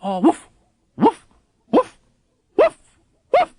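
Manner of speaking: a high, loud dog bark. Acted. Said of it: "he barks".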